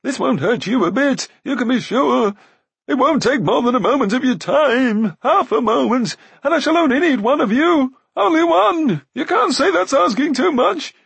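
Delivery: very high, loud wailing; frantic, loud high-pitched begging; Glue rising to frenzy. A narrator's reading. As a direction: build from pleading to a shrieking wail. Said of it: "Glue's voice had risen to a frenzy".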